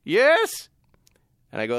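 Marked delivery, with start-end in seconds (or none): 0.0-0.7 high, loud mock Middle Eastern scammer; hammed-up Middle Eastern caller, pitched way up; Middle Eastern accent. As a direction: answer impatiently in a put-on Middle Eastern accent. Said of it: "angry Middle Eastern guys".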